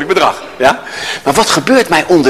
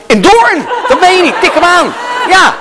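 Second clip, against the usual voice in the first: overexcited surprise; 11 semitones higher than usual. Shown as a demonstration. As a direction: gush at the answer way too much. Demo overreacting to answer.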